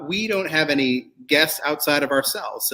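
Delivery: ordinary talk, nothing marked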